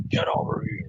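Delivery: lightly voiced Mortal Kombat cry; a hint of Scorpion's spear call; Scorpion impression. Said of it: "that's my scorpion impression".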